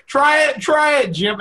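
high, loud teasing cry; excited teasing. Acted. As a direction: egg him on, loud and high. Teasing Jim to stop him.

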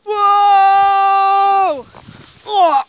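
long, loud amazed whoa; big startled cry; surprised shout. Acted. Sudden shock in snow.

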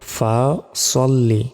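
ordinary talk

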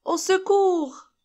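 high, drawn-out acted call for help; storybook alarm, pitched high and slow; cry for help. A narrator's reading. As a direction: cry out as a little pig in danger. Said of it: "cria le petit cochon".

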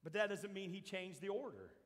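normal talking voice

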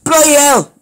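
put-on loud, high English-accented exclamation. Reader voicing the jolted taxi driver, shouted English accent. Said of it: "I had an English accent".

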